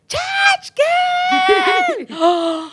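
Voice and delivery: very high-pitched drawn-out call; imitated shout. Woman hailing her at the mall, high imitated shout. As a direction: holler it high across a mall. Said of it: "And somebody shouted".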